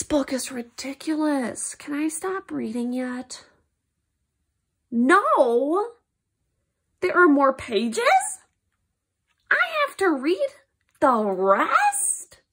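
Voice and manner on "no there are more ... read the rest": loud, high protest rising at the ends; stretched, incredulous questions; dismayed disbelief. Acted. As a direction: wail in mock horror. Reader dismayed that book continues.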